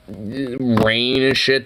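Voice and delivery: ordinary talk, nothing marked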